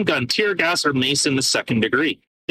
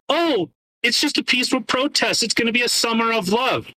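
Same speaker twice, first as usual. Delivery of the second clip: mocking; 7 semitones higher than usual. Mocking the mayor's words.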